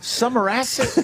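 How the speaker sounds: "Sumeracity" slurred into one word, pitch rising; mocking mispronunciation. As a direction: run "Sumeracity" together as a mock question. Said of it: "Are you allowed to just create words".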